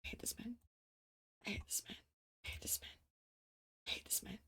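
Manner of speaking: breathy whisper of frustration; muttered whispered complaint. Real, not acted. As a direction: hiss it under your breath.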